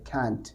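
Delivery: "can't" as khant with a breath after k; different accent. Shown as a demonstration. Teacher demonstrates a differing accent, softly said "can't" as khant. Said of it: "थोड़ा सा accent अलग".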